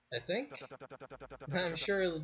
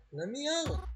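much higher pitch, rising at the end; higher desperate scream; 11 semitones higher than usual. Acted. Trapped in a coffin, begging Reginald, higher scream. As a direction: push the plea up into a shriek.

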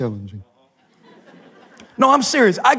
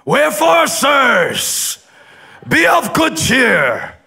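Paul proclaiming; much slower than usual. Acted. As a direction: shout it over the storm, then drop to a hush. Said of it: "Paul's standing up on the ship today saying".

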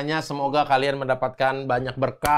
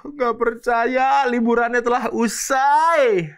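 hammed-up wail, higher and slow; mock lament; 9 semitones higher, much slower than usual. Host mock-wailing to listeners that the holiday ended. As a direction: whine like the holiday's over for good.

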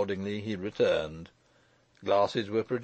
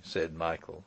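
ordinary delivery, nothing marked

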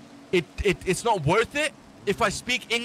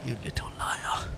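lower, soft menacing whisper; whispered accusation; 8 semitones lower, 8 dB quieter than usual. Acted. Accusing his lying friend, low menacing whisper. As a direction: hiss it like a villain.

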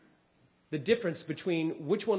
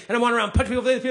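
building rant; 7 semitones higher, 12 dB louder, much faster than usual. Acted.

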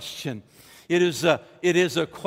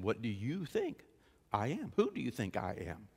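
soft, lowered question; quiet questioning; 4 semitones lower, 9 dB quieter than usual. Retold or imitated. Jesus asking the believer directly, soft and low. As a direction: drop low to put the question to them.

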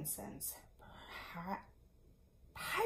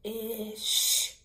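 loud, drawn-out Fish; teaching the word Fish; sounding out fish; 5 dB louder than usual. Shown as a demonstration. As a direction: say Fish slowly and loudly, as a word to learn. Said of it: "Nick tried one more".

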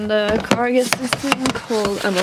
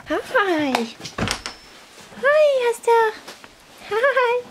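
high, bright call to the horses; high greeting voice; 11 semitones higher, much slower than usual. Real, not acted. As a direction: sing out a high hello to pets.

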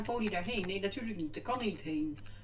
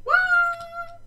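high whoop; 7 dB louder than usual. Real, not acted. Exclaims while falling.

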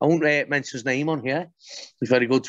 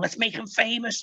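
higher, mocking sing-song; mocking online crowd; 6 semitones higher, 4 dB quieter than usual. Mimicking online crowd piling on a man, higher mocking voice. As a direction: sneer like a gleeful internet mob. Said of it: "everyone was online going".

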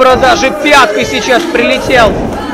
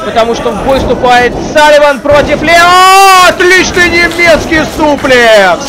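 higher excited live commentary; wrestling commentator; 4 semitones higher than usual. Real, not acted. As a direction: hype up the suplex. Live play-by-play of the match.

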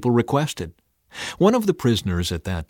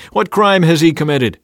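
loud, high demanding question; 7 semitones higher, 9 dB louder than usual. A narrator's reading. Pilate challenging the mob, loud demand. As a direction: fire it out as a challenge. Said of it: "Pilate demanded".

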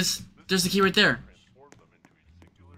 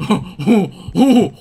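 panicked yelps; 6 semitones higher, 5 dB louder, much slower than usual. Real, not acted. Panicked yelps in horror game.

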